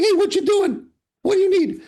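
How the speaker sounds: high squeaky grouch voice; Hammer's grumpy voice. Retold or imitated. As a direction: bark it out like a cranky old barman. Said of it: "He'd be like".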